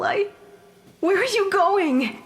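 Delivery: frightened plea to the departing doctor; panicked call. Acted. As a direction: call after him in alarm. Ghost panics as the doctor leaves.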